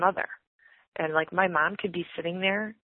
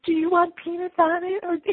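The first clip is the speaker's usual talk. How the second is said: higher-pitched mock eager offer; imitated obliging dad; 10 semitones higher, 3 dB louder than usual. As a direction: lightly act out an eager husband offering. Daughter voices dad's obliging reply.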